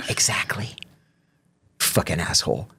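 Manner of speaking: soft, whispered retort; whispered aside. Retold or imitated. Inmate leaving the lieutenant's office, whispered parting retort. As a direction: whisper it under your breath as you leave.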